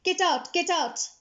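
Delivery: high, loud panicked yell; panicked shout. Acted. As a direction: scream to get out of the taxi. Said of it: "The passenger shouted".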